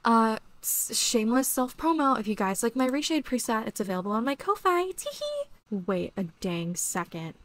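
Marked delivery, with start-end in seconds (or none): 4.9-5.4 very high, soft playful "tee-hee"; cutesy giggle. Pretend. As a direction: giggle coyly after the plug. Cutesy after self-promo.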